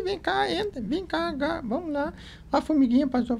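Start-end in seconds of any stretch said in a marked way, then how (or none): none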